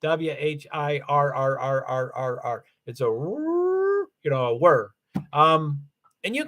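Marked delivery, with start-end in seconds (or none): 0.0-2.6 imitating an electric car whir; wind-up toy. Shown as a demonstration. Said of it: "they sound like a whir". 3.1-4.1 high and slow whir; high drawn-out whir. Shown as a demonstration. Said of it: "they sound like a whir".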